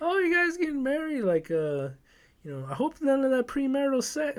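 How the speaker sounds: mock disapproving fairy godmother; prim old lady. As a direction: fuss like a nosy granny. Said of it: "she just pops out like".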